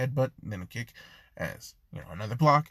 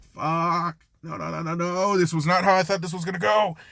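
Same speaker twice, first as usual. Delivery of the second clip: higher frantic outburst, building louder; panicked dismay; 5 semitones higher, 4 dB louder than usual. Acted. Izuku as Jean runs off crying, building panicked dismay. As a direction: start dismayed, build to a fast loud protest.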